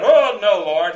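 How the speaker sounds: loud startled outcry of protest; Peter protesting. Acted. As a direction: cry out as Peter, shocked and refusing. Preacher voices Peter protesting Jesus.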